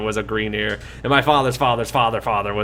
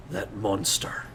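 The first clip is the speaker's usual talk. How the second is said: grim muttering; 2 semitones lower, 8 dB quieter than usual. Acted. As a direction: say it under the breath, held-in anger. Dwarf finds his brothers slain.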